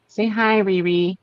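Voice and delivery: high coaxing voice for a pet; pet talk. Real, not acted. Owner coaxes pet to say hi, high pet talk. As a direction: coax the pet to greet the friend.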